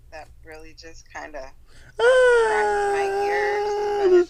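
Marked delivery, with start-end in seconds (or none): 2.0-4.3 drawn-out whiny cry; pained wail. Acted. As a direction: stretch it out in agony. Complaining about Spain lisp.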